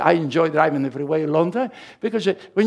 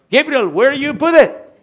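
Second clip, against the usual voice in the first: higher, louder, impatient wife voice; a nagging wife demanding to know where it is; wife's exasperated question; 8 semitones higher, 6 dB louder than usual. Retold or imitated. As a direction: play the fed-up wife asking where it went. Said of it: "Raquel sometimes said".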